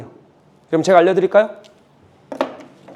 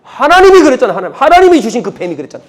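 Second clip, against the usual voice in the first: loud, raised-voice retort; Eve shifting blame; 4 semitones higher, 6 dB louder than usual. Acted. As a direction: throw the blame back at God. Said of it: "하와는 뭐라 그런지 아십니까?". (남 탓하는 하와처럼 큰 소리로)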